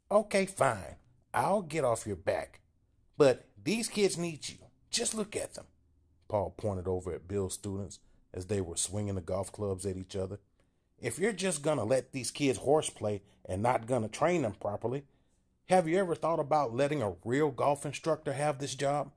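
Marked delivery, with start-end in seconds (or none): 0.1-5.7 high, louder pinched voice; small man pushing hard; Paul urging Bill to look at the kids, high and loud; timid Paul insisting. A narrator's reading. 11.0-19.1 high, louder pinched voice; small man pushing hard; Paul urging Bill to look at the kids, high and loud; timid Paul insisting. A narrator's reading.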